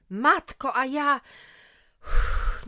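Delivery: loud cry then exhale of relief; relieved exclamation. Acted. Mother realizes it's minor, relieved. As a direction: gasp then let the fear out.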